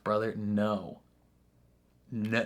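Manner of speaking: usual speaking voice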